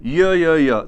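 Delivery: ya, ya, ya, spelled out like a drill; sounding out ya. Shown as a demonstration. Teacher to students, sounding out the y of European. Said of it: "जब इसको हम pronounce करते हैं".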